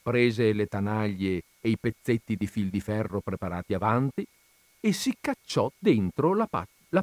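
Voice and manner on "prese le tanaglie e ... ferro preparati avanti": ordinary delivery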